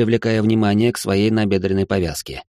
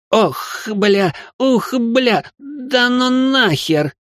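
гораздо выше, растущий ужас и растянутое «нахер»; вопи в ужасе, не веря глазам; потрясённый вскрик; much slower than usual. A narrator's reading. Richard shocked at the gift. (Ричард в шоке от подарка, гораздо выше, тянет)

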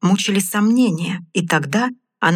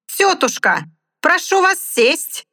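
clown shout; 11 semitones higher, slower than usual. Acted. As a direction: call to the dog for the crowd. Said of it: "крикнул хозяин".

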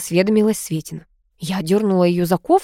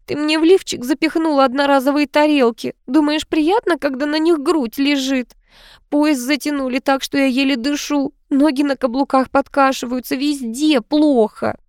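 high-pitched string of grievances; whining; 7 semitones higher than usual. A narrator's reading. Niece complaining about her uncomfortable outfit, whining. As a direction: complain in a high, put-upon whine. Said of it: "заныла та".

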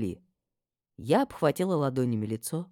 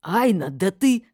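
loud, higher-pitched angry outburst; вскипевшая мама; 6 semitones higher, 8 dB louder, slower than usual. A narrator's reading. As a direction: boil over at your daughter, slow and loud. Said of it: "вскипела мама". (мама вскипела, громко и гневно)

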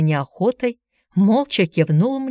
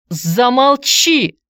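loud, high, drawn-out command; sudden yell; 6 semitones higher, 5 dB louder, much slower than usual. A narrator's reading. Boy silences his aunt at the table, yelling. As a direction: bellow to silence someone. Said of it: "вдруг заорал Антон".

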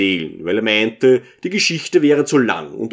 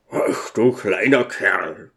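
1 touched; 5 semitones lower, slower than usual. A narrator's reading. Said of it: "rief Apchila gerührt".

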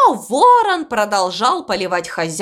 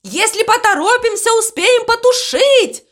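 very high, urgent crow's cry; urgent shouting; 12 semitones higher than usual. A narrator's reading. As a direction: urge them on in a high panic. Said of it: "кричал он". (отчаянный, срочный крик высоким голосом)

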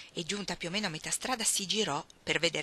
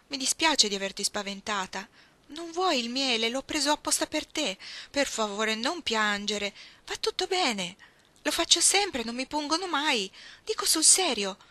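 lightly voiced girl, higher, eager to comfort; coaxing Iggy; 6 semitones higher, 4 dB louder than usual. Iggy offering honey to calm Ruth, lightly voiced coaxing. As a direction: talk her out of tears.

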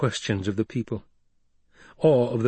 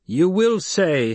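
slow, higher, louder, every word weighted; commanding Voice; 8 semitones higher, 4 dB louder, slower than usual. Acted. Paul using the Voice on the crowd, slow and commanding. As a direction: command them with the Voice. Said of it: "the powers of voice she had taught him".

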